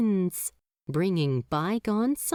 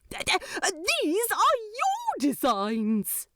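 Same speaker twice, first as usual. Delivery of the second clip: stammering angel; 12 semitones higher than usual. A narrator's reading. Aziraphale holding up the manual, stammering high. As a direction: stumble over the words in awe. Angel figure voiced in story.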